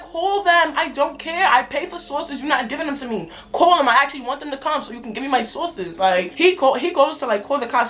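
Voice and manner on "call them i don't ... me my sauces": higher-pitched, defiant comeback; defiant retort. Retold or imitated. Customer to worker threatening to call the cops, defiant retort. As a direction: call his bluff, pitch raised.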